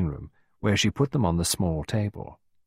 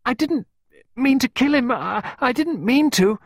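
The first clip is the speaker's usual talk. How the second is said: put-on very high, loud child's wail; acted hysterical crying; hysterical sobbing; 5 dB louder than usual. A narrator's reading. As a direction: cry it out hysterically, breaking up the phrases. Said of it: "Sobbing hysterically, she cried".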